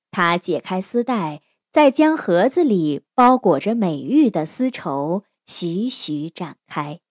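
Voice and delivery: ordinary delivery, nothing marked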